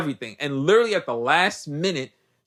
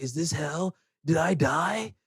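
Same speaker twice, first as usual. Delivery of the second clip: dazed murmur; 6 dB quieter than usual. Retold or imitated. Edward waking, dazed in retelling.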